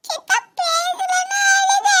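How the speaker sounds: put-on high, weepy childish protest; a little girl insisting through tears; tearful child. Niece tearfully protests to her mother.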